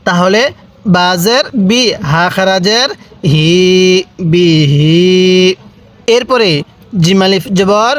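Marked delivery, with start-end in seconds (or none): 3.3-5.5 slow Qaida drill, steady drawn-out "হি"; one-alif stretch. Shown as a demonstration. Teacher showing learners the Qaida rule, "হি" held long. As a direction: hold the "হি" in "বিহি" long on a level note. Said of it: "এক আলিফ টান দিছি".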